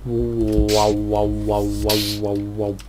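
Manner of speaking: low, pulsing wow-wow hum; vocal warbling on one low note; beam noise imitation. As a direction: make a droning low beam sound.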